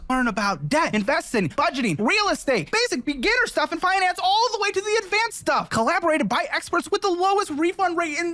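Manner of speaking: upbeat sponsor pitch, rising excitement; ad read. Acted. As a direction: sell it like a YouTube ad. Reads sponsor ad.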